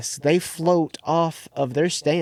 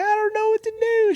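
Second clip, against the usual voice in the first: high-pitched imitation of a crying girl; mock crying. Crying girl lost in the graveyard, high mock wail. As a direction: wail it in a put-on, much higher voice. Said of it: "She's crying".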